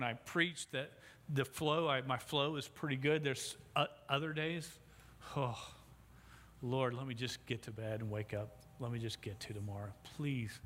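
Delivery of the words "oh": ordinary talk, nothing marked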